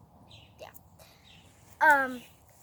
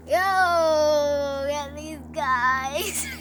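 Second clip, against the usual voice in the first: loud, drawn-out mock command; calling the guards; 8 dB louder, much slower than usual. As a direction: holler for the guards, stretching the words. King summons his guards.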